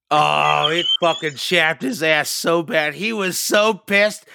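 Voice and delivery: high, loud crowing; gleeful outburst. Real, not acted. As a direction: crow loudly over winding him up. Gleeful telling of the dad's anger.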